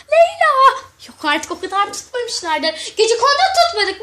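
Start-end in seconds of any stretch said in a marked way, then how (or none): 0.1-0.8 high, loud call across the house. Acted. Mother calls her daughter Leyla from another room, loud and high. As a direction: call her name from afar.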